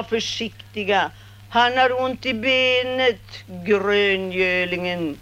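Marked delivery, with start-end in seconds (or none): none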